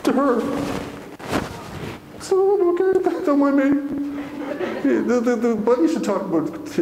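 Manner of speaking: struggling to hold back happy tears; teary. Real, not acted. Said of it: "I'm so happy it leaks out of me".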